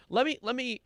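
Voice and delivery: ordinary talk, nothing marked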